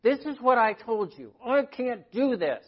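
upset outburst. Retold or imitated. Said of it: "she pointed to herself and she said".

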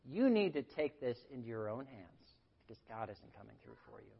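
quiet, low sly tempter voice; a hint of a whispering tempter; lightly voiced devil coaxing the anxious; Satan tempting. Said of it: "he loves to say".

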